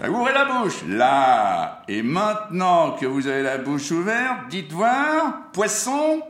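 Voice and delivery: very high, loud ringmaster command; bossy ringmaster. Acted. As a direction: bark the order like a showman. Ringmaster demands the word poisson.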